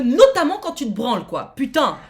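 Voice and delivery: loud, heated punch on notamment; worked-up rant. Real, not acted. Streamer defends the viewer's right to privacy, angry outburst. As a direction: push it out in real irritation. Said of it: "Pardon, je m'énerve".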